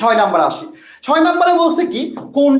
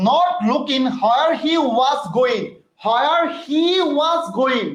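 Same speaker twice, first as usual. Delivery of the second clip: slow drilled phrase; 3 semitones higher, much slower than usual. Shown as a demonstration. Teacher drilling an English phrase.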